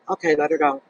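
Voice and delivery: high, light voice of someone releasing; imagined voice. Retold or imitated. Describing pain ending, a lightly voiced order to release. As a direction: lightly voice an unseen hand letting go. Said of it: "That's just what it felt like".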